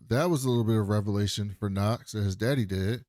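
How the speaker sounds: ordinary talk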